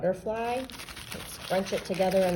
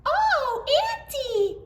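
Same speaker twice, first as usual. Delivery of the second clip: high, loud mock-surprised cry; cried out; 4 dB louder, slower than usual. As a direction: squeal it in a little girl's voice. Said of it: "cried Little Red".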